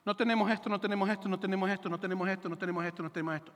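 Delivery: droning litany of excuses, sinking steadily; monotonous mock complaint, trailing downward; imitating complainers. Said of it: "empieza la gente".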